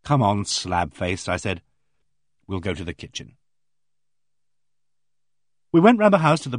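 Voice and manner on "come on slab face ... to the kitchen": gentle coaxing. Acted. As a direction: coax her kindly toward the kitchen. Coaxing a shocked girl.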